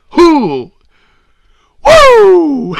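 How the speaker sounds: high, loud wrestler's whoop; Ric Flair. Acted. Host crowing over the backstab, wrestler whoop. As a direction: let out a piercing showman's "woo". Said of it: "That's my Ric Flair impersonation".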